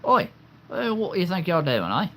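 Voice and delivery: high, cheeky British accent; put-on British accent. Shown as a demonstration. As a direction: challenge someone in a high-pitched British voice. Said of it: "I can do a little bit of accent".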